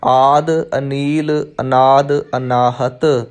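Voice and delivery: ਆਦ, ਅਨੀਲ, ਅਨਾਦ, ਅਨਾਹਤ each said separately; word by word. Shown as a demonstration. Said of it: "ਇਹਨੂੰ ਕੱਲਾ ਕੱਲਾ". (ਆਦ, ਅਨੀਲ, ਅਨਾਦ, ਅਨਾਹਤ ਕੱਲਾ ਕੱਲਾ ਸ਼ਬਦ)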